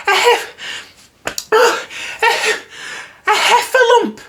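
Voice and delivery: very high stammering squeak, terrified; squeaky Piglet voice. Acted. Piglet stammering to Christopher Robin, very high and scared. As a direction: stutter out the monster's name, high and scared. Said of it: "doing that Piglet voice screaming".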